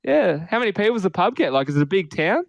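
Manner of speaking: a hint of a chatty grandad asking away; imitating grandpa. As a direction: pepper the questions like a curious pop. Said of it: "And he's like".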